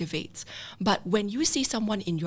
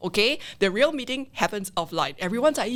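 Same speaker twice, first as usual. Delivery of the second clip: ordinary delivery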